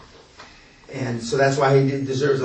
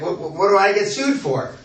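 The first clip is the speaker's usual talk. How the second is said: baffled question; 6 semitones higher than usual. Retold or imitated. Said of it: "he didn't perceive".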